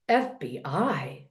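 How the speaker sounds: low, slow mock disbelief; crazy voice. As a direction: draw it out in a low, odd voice. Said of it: "with this crazy voice".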